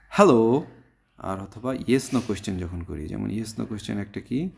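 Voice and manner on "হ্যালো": loud, high "হ্যালো" rising at the end; voice going up. Shown as a demonstration. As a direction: call "হ্যালো" with an upward swing. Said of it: "আমাদের voice up হবে". ("হ্যালো" গলা উপরে তুলে)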